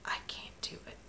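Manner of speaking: barely whispered imitation of choked-up words; recounting a tearful, almost voiceless reply; retold teary-eyed. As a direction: whisper it softly, as if close to tears. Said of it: "she got teary-eyed".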